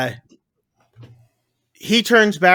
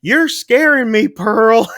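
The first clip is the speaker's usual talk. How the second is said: higher, louder retold alarm; mock frightened protest; 6 semitones higher, 5 dB louder, slower than usual. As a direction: play him spooked, pitched up and loud.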